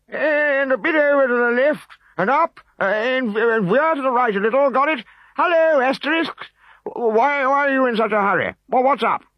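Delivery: a pompous chief bossing his bearers; high loud pompous chief; fussy old chief. A narrator's reading. Chief Vital Statistics voiced.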